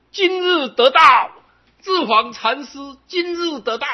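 high, loud proclamation from the sky; heavenly broadcast. Retold or imitated. Voice from the sky announcing the monk's awakening, loud high proclamation. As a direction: boom it out like a voice from heaven. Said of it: "空中广播".

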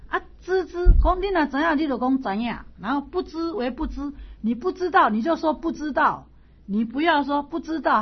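high, loud, insistent lecturing; emphatic Confucius. Acted. Confucius insisting to Zilu, loud and high. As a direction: hammer each phrase at the class.

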